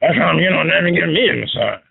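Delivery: hammed-up Sasquatch wife complaining. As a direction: growl it like a hairy beast. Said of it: "you sound like Sasquatch".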